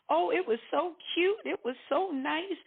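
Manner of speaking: high, mock-sweet gushing. Recalling the flowers and cocktail party, gushing. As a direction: coo over it in a lifted, singsong pitch. Mimics gushing about the wedding.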